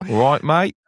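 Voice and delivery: put-on light Aussie accent greeting. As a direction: toss it off in a quick Aussie accent. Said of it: "do an Aussie accent for that".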